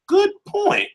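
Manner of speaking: high, surprised "good point"; sudden surprised agreement; surprised concession. Real, not acted. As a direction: caught off guard, concede it. Surprised concession to a chat question.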